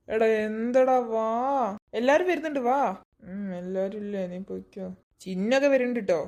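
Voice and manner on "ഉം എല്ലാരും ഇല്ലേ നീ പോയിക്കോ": much softer and lower, half asleep; sleepy mumble. Acted. Sleepy friend refusing to get up. (ഉറക്കച്ചടവോടെ പിറുപിറുത്ത്)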